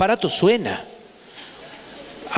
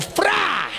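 a sudden high pitched "fra" as the neck snaps; mock sharp cry; 12 semitones higher than usual. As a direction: mimic the grandmother's quick killing sound. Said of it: "mi abuela decía". (grito agudo y breve imitado)

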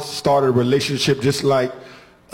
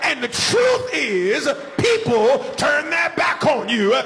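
high, chanted, shouted sermon climax; full-force preaching; 10 semitones higher than usual. Acted. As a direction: whoop it up over the organ. Preacher builds into whooping climax.

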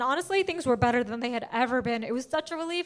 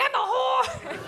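high yell; 11 semitones higher, much slower than usual. Retold or imitated. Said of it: "I'm like".

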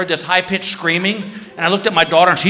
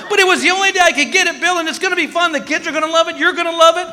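high, fast mock wife voice gushing; hammed-up wife selling the trip; mimicking his wife; 11 semitones higher, faster than usual. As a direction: pitch way up, rush it like an eager wife. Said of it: "And she goes".

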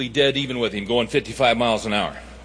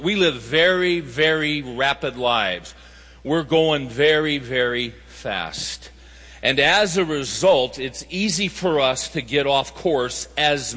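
slowed for emphasis; slower than usual. Real, not acted. Preacher slowing to drive point.

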